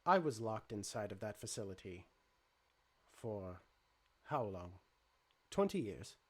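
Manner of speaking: low, even, measured robot; calm robot voice. Acted. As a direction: speak like a calm android.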